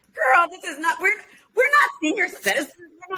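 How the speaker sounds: playful outrage at being called a senior; indignant mock shout. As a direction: shout it back, high and offended, half laughing. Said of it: "I'm like".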